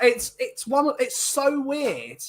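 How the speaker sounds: usual speaking voice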